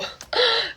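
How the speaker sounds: high sharp gasp; shocked gasp. Retold or imitated. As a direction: gasp in disbelief. Shocked after being hit.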